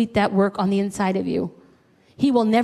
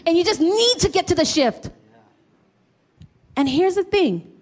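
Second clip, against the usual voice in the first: ordinary talk, nothing marked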